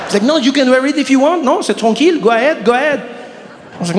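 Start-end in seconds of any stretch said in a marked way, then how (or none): 0.2-1.6 put-on higher-pitched French accent; mock-gracious French salesman; French shop assistant. Salesman allowing customer his choice, French accent. 1.8-3.0 put-on breezy, higher French accent; French shop assistant. Salesman waving customer on, French accent.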